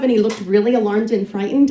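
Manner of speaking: plain reading voice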